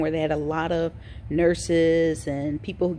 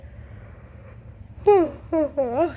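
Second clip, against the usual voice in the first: long tired sigh; 10 semitones higher than usual. Real, not acted. Tired vlogger sighs again.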